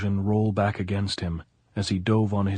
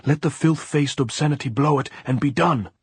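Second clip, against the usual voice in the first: high, raised-voice cursing; raging; 6 semitones higher, 4 dB louder than usual. A narrator's reading. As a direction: spit out the curse in fury.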